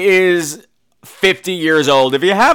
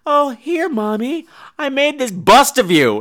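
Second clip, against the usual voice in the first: sweet daughter; 7 semitones higher, slower than usual. Acted.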